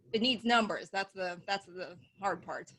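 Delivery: ordinary talk, nothing marked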